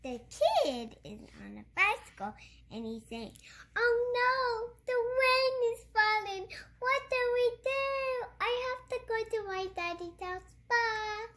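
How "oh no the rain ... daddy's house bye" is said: put-on high, sing-song panicked kid; high playful kid voice; kid's voice. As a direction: squeal it like a small child caught in rain. Child voices kid on bicycle.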